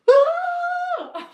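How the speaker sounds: imitating a long high scream; mock terrified shriek, held; retold scream. Recalling both screaming at each other in the hallway, long high shriek. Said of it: "at first it was like".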